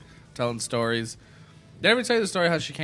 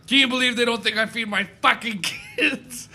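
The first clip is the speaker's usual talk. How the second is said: put-on drunk mom ranting; 6 semitones higher than usual. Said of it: "She's like".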